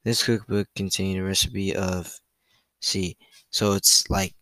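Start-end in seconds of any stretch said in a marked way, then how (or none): none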